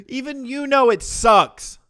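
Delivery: very high, very loud jeer; gleeful yelling. Real, not acted. Host jeering at the video maker's apology, high gleeful yell. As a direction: yell it straight out of a laughing fit.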